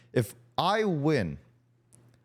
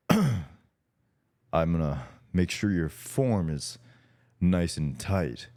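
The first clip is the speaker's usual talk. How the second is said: pitched-down bro voice, as his friend asked; deeper voice; 10 semitones lower than usual. Shown as a demonstration. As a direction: drop it down for the act. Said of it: "say it with a deeper voice".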